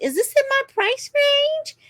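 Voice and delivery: high-pitched, put-on questioning voice; imitated customer. Customer asking the car salesman about price, imitated in a high voice.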